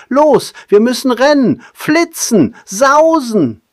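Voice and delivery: high, loud, breathless hurry; a frantic monkey rushing his friend; excited little monkey. Acted. Pippo urges Barnaby to run.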